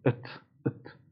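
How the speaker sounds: ات whispered, just a soft t release; unvoiced ات, only the t; whispered final t. Shown as a demonstration. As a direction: breathe out ات as a bare t with no vowel. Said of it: "آخرش هم ات نمیگم".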